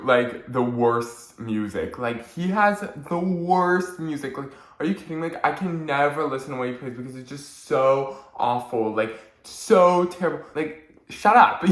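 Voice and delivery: a mean friend building from sneer to shrill outburst; mocking gossip. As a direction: imitate a snide friend trashing someone's music. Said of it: "being like".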